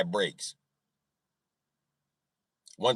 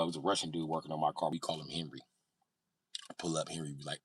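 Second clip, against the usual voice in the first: normal talking voice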